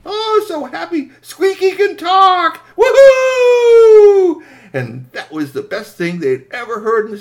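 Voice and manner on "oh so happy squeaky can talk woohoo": high and slow, building to a very loud whoop; overjoyed cheering. Acted. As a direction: let the joy burst into a shout. Everyone celebrates Squeaky talking.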